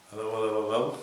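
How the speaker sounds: light Lincolnshire accent, mock police officer greeting; Lincolnshire bobby accent. Trying on a police hat, playing a Lincolnshire bobby. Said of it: "a bit of a Lincolnshire accent".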